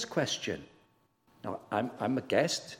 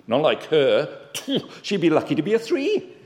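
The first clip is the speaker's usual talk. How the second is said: a touch of scornful, louder put-on voice, with a laugh; mock smug judgment; 2 semitones higher, 7 dB louder than usual. Preacher voicing a self-righteous churchgoer, mock scornful. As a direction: sneer lightly at her score.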